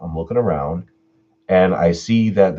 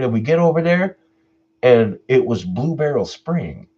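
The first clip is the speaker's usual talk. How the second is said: ordinary talk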